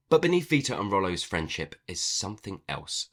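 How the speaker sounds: ordinary talk, nothing marked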